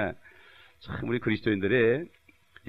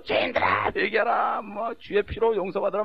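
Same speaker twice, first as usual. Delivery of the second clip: mock street preaching; 9 semitones higher, slower than usual. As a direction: belt it like a fiery evangelist. Daughter preaching as evangelist.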